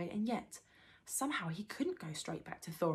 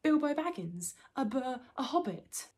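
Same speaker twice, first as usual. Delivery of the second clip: high, flustered hobbit voice; frightened stammer; 7 semitones higher, 4 dB louder than usual. A narrator's reading. Caught hobbit naming himself to trolls, frightened. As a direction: stumble over it, high and scared. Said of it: "shaking all over".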